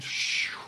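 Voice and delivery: breathy whoosh of parting water; whooshing water sound. Acted. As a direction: voice the sea rushing apart.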